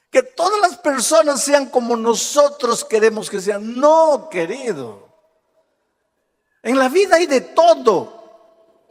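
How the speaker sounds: impassioned preaching. Real, not acted. Preacher urging congregation to accept people, impassioned.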